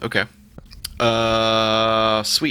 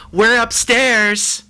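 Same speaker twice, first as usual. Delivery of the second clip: high, slow, strange voice; weird voice; 9 dB louder, much slower than usual. Acted. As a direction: stretch it out in a bizarre high voice. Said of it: "in a weird voice".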